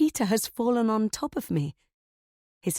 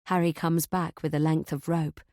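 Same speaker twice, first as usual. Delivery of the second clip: usual speaking voice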